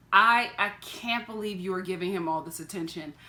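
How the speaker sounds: ordinary talk, nothing marked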